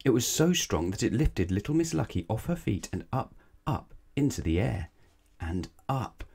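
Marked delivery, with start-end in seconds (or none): none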